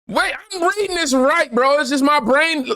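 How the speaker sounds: high-pitched, comic self-exasperation; flustered high voice. Real, not acted. Host flustered at misreading a comment, squeaking high. Flustered at stumbling over the reading.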